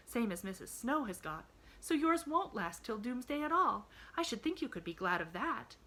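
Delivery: put-on high, cheerful child explaining; glad little girl, consoling. A narrator's reading. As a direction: reassure him in a girlish lilt.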